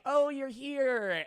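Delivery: high, drawn-out imitated greeting; mock delighted greeting. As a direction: imitate her, stretching it out way up high. Possessed girlfriend greets him amid the mess.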